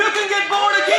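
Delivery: very high, shouted sermon chant; full-force preaching. Real, not acted. Preacher proclaiming new birth to his church, full-force preaching. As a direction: cry it out to the congregation in punched phrases. Preaching climax, calling to congregation.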